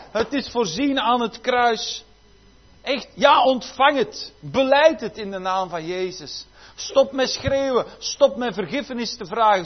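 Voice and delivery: high, loud, urgent preaching; preacher calling the church to receive forgiveness, urgent and loud; full-force preaching. Acted. Pastor urging the congregation to stop begging, full-force preaching.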